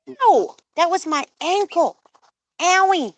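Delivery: high-pitched ouch voice; pained protest. Real, not acted. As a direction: squeal in protest at a nip. Bird bites speaker's ankle.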